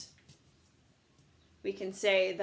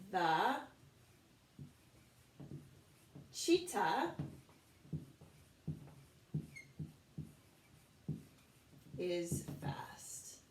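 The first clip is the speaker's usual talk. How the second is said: slow, broken by long pauses, ending in a whisper; word by word dictation; 6 dB quieter, much slower than usual. Shown as a demonstration. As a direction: say it piece by piece while writing. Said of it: "so let's try that".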